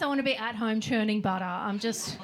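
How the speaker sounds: ordinary talk, nothing marked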